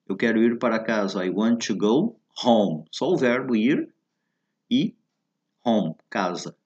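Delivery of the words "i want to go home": slow model phrase. Shown as a demonstration. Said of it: "quando se diz".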